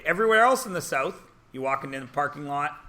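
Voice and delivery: ordinary talk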